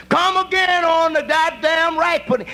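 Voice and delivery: high, slow holler back to the kitchen; hollered order. Retold or imitated.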